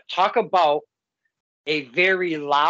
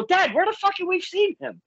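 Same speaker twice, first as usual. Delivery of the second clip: high, fast, baffled question; quick high-pitched demand to Dad; puzzled exclamation; 12 semitones higher, faster than usual. Retold or imitated. Speaker acts out asking dad.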